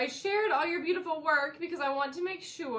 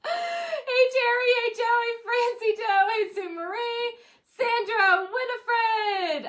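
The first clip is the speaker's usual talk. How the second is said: much higher and louder happy hellos; joyful greetings; 11 semitones higher, 6 dB louder, slower than usual. Real, not acted. Host greeting graduates by name, high and bright. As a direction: greet each one like a long-lost friend.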